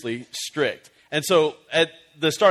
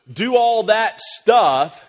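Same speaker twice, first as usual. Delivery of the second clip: louder and slow; slow emphatic push; 6 semitones higher, 6 dB louder, much slower than usual. Acted. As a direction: lean on it, drawn out. Listing parental duties.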